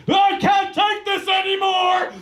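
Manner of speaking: high, slow, strained yell of despair; anguished shout. Retold or imitated. Jacob at the prom cracking, very high mock anguished shout. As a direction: cry out like he's about to burst. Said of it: "Jacob is like".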